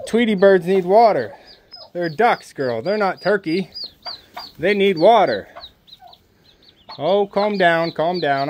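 usual speaking voice

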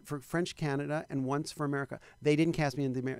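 ordinary delivery

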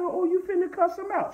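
high, squeaky, disbelieving question; mock surprised. Preacher retelling his own shocked question to his wife, high squeak. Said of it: "I said".

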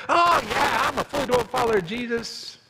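mock pious. Acts fake pious believer.

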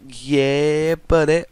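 high, loud yeah buddy; excited cheer. Real, not acted. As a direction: cheer like you nailed it. Cheers at result.

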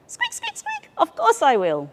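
very high squeaky mouse voice; tiny mouse answering. A narrator's reading.

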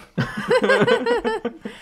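éclat de rire très aigu; grand éclat de rire. Real, not acted. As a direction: rire franc qui part dans les aigus. Rit de sa propre blague. (gros fou rire)

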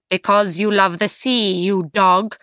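mildly scornful storybook outburst; angry cry. A narrator's reading. King of giants punishing the giant, mildly angry. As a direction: hurl it as an insult, lightly acted. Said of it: "he cried".

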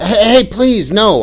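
high, loud plea calling out; a boy begging his father back; calling after. Retold or imitated. Boy calling after his departing father, high loud plea. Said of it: "I called after him".

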